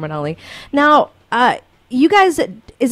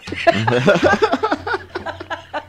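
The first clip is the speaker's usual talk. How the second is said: high, long laugh; 7 semitones higher than usual. Real, not acted. Host laughs at joke.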